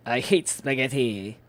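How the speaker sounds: slow, loud hammed-up villain voice; Evil Papyrus. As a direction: boom it out like a cartoon villain skeleton. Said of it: "Evil Papyrus".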